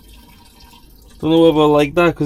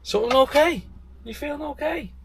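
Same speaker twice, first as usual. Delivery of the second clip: put-on high, teasing old man's voice, fading off at the end; imitating grandad; 13 semitones higher, 5 dB quieter than usual. Grandad teasing grandson for doing dishes, high mock concern. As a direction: grandad pretending to worry about his grandson. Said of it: "he'd be like".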